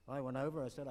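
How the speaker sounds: ordinary talk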